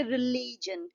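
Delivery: Religion with a long, stressed middle syllable ली; stress on second syllable. Shown as a demonstration. Teacher showing students syllable stress, religion stressed on its middle. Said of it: "री पे जो है focus ज्यादा है".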